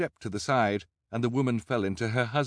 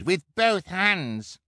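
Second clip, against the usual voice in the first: high, stretched-out boast; emphatic, drawn-out character voice; eager cry; 11 semitones higher, 4 dB louder, much slower than usual. A narrator's reading. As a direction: cry it slowly and proudly, pitched up. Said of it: "Kevin cried".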